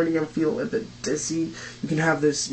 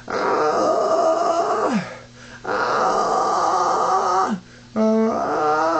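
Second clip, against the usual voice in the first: high crunchy screamed "ah"s; short screamo shrieks on a high note; 10 semitones higher, much slower than usual. Shown as a demonstration. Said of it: "like this".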